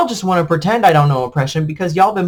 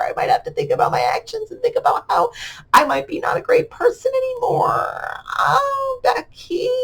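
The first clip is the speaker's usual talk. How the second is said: high, hammed-up mock sob; mock crying; 4 dB quieter than usual. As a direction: wail it like fake tears, much higher.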